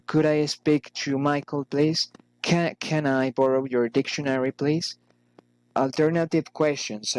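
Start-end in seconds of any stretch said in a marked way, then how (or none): 0.1-5.0 "please" rising at each end; rising intonation. Shown as a demonstration. Teacher models polite requests, rising intonation on "please". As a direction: lift "please" to make a request. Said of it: "rise in intonation".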